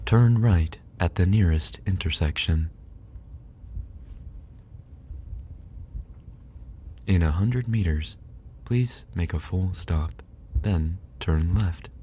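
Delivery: a light, lightly voiced sat-nav read; GPS voice. Friend says he sounds like a GPS, mock sat-nav. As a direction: give directions like a mild navigation voice. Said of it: "You sound like my G P S".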